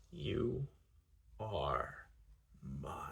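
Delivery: hushed, low, each word set apart; low snarl. A narrator's reading. Possessive man to his lover, snarling each word. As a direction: growl it word by word, with long pauses. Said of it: "he snarls, emphasizing each word".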